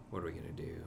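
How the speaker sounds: whispered to himself. Real, not acted. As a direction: murmur the question in a whisper. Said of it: "whispers to self".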